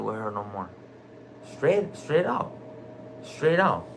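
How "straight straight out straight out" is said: higher, slow "Straight, straight out. Straight out.", every word pressed out; emphatic insistence. Real, not acted. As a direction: spell out "straight out" slowly and higher, then land it.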